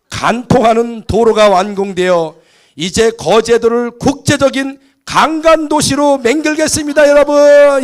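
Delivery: orate like a Gyeongsang president at a ribbon cutting; Gyeongsang accent. Retold or imitated. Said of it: "경상도 말로 한 겁니다".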